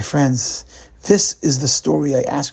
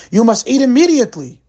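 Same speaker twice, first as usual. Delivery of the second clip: high, loud SS officer barking an order; shrill threat to the prisoners; high-pitched command; 10 semitones higher, 5 dB louder than usual. A narrator's reading. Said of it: "commanded in a high-pitched voice".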